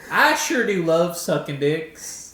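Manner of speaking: slow, twangy cowboy drawl. Shown as a demonstration. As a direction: lay on a hokey cowboy voice. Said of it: "it's cowboy voice".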